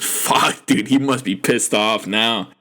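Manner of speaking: ordinary delivery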